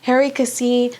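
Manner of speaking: usual speaking voice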